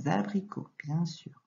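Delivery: normal talking voice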